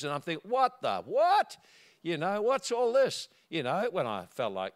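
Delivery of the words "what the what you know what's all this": high, raised mock outrage; indignant disbelief. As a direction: act out baffled indignation. Acted indignant reaction to being yelled at.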